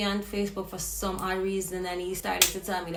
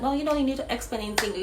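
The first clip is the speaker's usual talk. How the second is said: fast, high dismissive refusal; hurried refusal; 6 semitones higher, much faster than usual. Retold or imitated. Woman refusing her friend's excuse, fast and high. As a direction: rush the brush-off out. Brushing off friend while fleeing.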